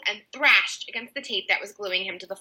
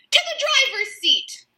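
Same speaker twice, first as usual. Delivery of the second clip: spluttered; 3 dB louder than usual. A narrator's reading. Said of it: "George spluttered".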